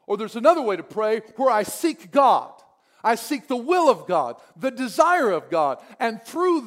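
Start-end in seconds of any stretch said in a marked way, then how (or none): none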